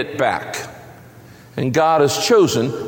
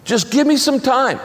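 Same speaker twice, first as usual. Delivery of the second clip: higher, mildly pleading plea for time; pleading debtor; 7 semitones higher than usual. A narrator's reading. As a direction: beg lightly for more time, voice lifted. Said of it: "And the man pleaded".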